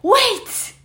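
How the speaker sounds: high called-out "Wait!"; a character yelling after friends; shouted call. Acted. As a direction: call after them to stop. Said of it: "a voice shouted".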